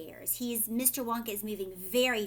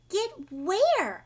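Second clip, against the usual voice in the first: eager Veruca; 9 semitones higher, 8 dB louder than usual. A narrator's reading. Veruca demands to know.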